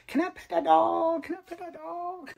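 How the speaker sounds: put-on high squeaky little-kid voice, trailing off; high childlike meme imitation. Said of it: "and I laugh every".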